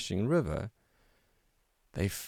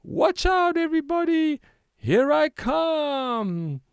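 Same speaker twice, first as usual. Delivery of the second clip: much higher and louder, stretching out here I come; excited shout; 6 dB louder than usual. A narrator's reading. Waldo warns everybody as he runs to jump, shouting. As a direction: yell it gleefully before the jump. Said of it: "Waldo shouted".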